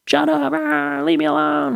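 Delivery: high-pitched imitation of a kid's angry yell; mock tantrum. Son lashing out at validating dad, mock tantrum.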